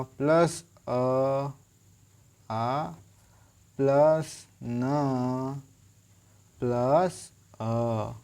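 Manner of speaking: each sound part drawn out very slowly; spelling out sounds. Shown as a demonstration. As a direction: build the word sound by sound. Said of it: "आधा होगा". (ध्वनियाँ बहुत धीरे-धीरे अलग-अलग बोलना)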